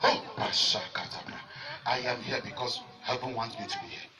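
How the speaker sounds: soft aside. Real, not acted. Preacher aside, much softer.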